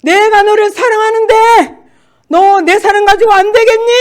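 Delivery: God's thundering voice. Retold or imitated. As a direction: call out like thunder from heaven. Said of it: "그런 음성이". (천둥 같은 하나님 음성)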